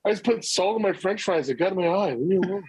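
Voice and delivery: high, playful fake excuse voice; hammed-up denial, fighting back tears; mock flustered excuse. Dad insisting to his son he isn't crying, high mock voice.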